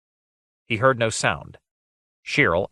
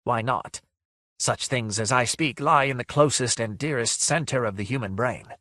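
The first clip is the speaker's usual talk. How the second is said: sighing phantom voice, trailing softer; lightly voiced mournful ghost; 3 semitones higher, 4 dB quieter than usual. Ghost answers Guile about its human voice, mournful sighing. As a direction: answer as a sad apparition.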